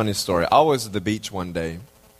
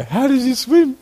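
much higher, puzzled voice; curious onlookers; 12 semitones higher, 5 dB louder than usual. Retold or imitated. Retelling hotel guests asking, high puzzled voice. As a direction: ask it like amazed hotel guests. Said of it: "These guys go".

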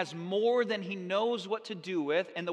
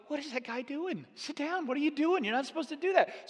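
lightly acted, high-pitched scolding aside; quick, high, half-hushed telling-off in pretend disbelief; mock disapproval; 6 semitones higher than usual. Congregation to the man interrupting the sermon, mock disapproval.

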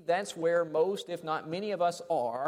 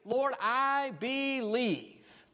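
slow, drawn-out cry, pitched higher; bold loud declaration; 6 semitones higher, much slower than usual. Shown as a demonstration. Preacher showing the father crying out his faith, slow and bold. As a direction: proclaim it boldly, stretching every word. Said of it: "This is a bold, loud declaration of faith".

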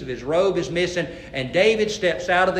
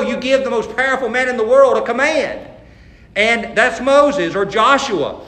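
ordinary delivery

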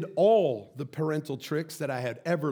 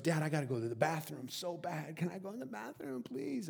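pleading child imitation; 8 dB quieter than usual. Daughter asking dad for the bathroom, pleading. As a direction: beg dad like a little kid. Daughter begging to leave table.

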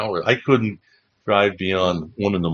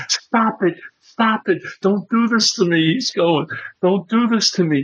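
pleading John; 11 semitones higher than usual. Retold or imitated. John begging him to stop.

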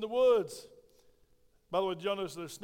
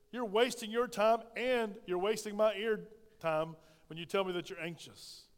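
ordinary delivery

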